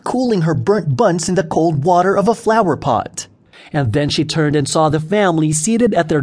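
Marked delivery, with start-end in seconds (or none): none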